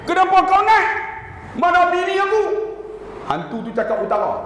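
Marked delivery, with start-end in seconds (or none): 0.1-2.4 high, loud startled shout; startled cry. Pretend. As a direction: shout out in shock at the ghost. Husband startled by ghost. (jeritan terkejut)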